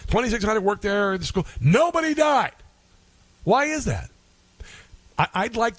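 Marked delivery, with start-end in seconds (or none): 1.6-4.1 high-pitched, incredulous outburst; emphatic incredulity. Real, not acted. Host arguing nobody at the school died, incredulous. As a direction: pitch it way up in disbelief.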